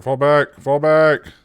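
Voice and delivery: urgent yelled retreat orders; shouting orders over battle. Real, not acted. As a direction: bark the order to fall back. Commanding troops in a siege.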